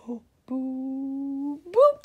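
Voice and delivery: usual speaking voice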